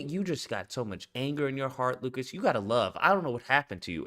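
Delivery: a mock sanctimonious lecture, hushed and low; mock pious scolding. Host imitating critics lecturing him, soft low sanctimonious tone. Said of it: "they were telling me".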